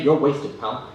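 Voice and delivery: ordinary delivery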